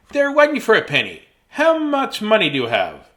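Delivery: high, loud candy seller; lightly voiced shopkeeper. Shop man voiced in story.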